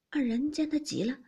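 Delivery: plain reading voice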